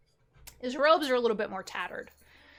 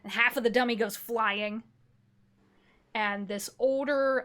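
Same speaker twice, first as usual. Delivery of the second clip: usual speaking voice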